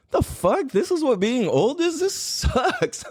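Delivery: high mock outrage, retelling his younger self; disgusted teen. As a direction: sneer at it like a bratty teenager. Kid self reacting, disgusted.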